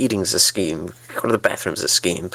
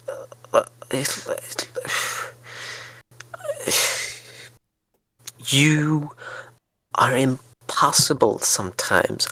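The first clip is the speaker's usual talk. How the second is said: exasperated whisper; 3 semitones higher, slower than usual. Acted. Exasperated at partner.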